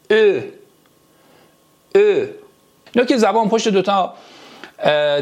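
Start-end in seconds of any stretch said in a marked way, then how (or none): 0.1-2.3 "ا" with rounded lips, jaw back; rounded front vowel. Shown as a demonstration. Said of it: "فک عقب".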